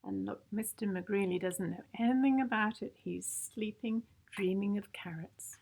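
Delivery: usual speaking voice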